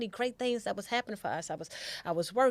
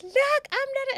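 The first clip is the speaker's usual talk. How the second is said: excited; 8 dB louder, slower than usual. Retold or imitated. Telling her dad excitedly.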